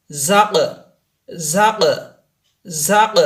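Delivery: জাক with a bouncing echo on the final ক; qalqala bounce. Shown as a demonstration. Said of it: "কলকলা। এখানে ধাক্কা দিয়ে পড়তে হবে". (জাক কলকলা দিয়ে ধাক্কা)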